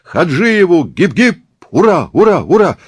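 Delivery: high, loud hip-hip-hurrah; rousing cheer. Acted. Gang celebrates Hadzhiev's decision, cheering. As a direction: chant like a cheering crowd.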